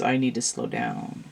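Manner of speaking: calming down. Acted. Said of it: "This is".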